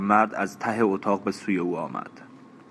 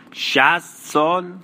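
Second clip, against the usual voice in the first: loud, high, drawn-out astonished question; incredulous; 8 semitones higher, 6 dB louder, much slower than usual. Acted. Régine questions his sixty-year claim, incredulous. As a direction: echo it back in slow, rising disbelief.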